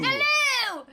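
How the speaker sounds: high, loud mock-British echo. Echoing the British word for toilet, mock British. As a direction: echo it in an exaggerated British accent.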